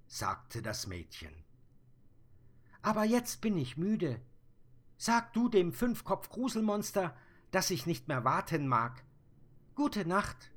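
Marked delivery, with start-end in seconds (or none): 2.8-10.5 put-on high, louder child voice; sleepy little girl. Little girl telling the Sandman she is tired, high and loud. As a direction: say goodnight as a tired small girl.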